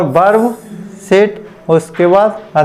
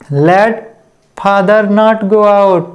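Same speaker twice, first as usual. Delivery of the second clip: each word drawn out very slowly; slow dictation; much slower than usual. Shown as a demonstration.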